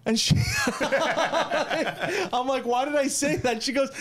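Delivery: high-pitched, tickled by the memory; amused, laughing retelling. Real, not acted. Retelling mom's explanation of his old accent, laughing. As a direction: tell it while cracking up, voice pushed way up. Cracking up at his childhood tape.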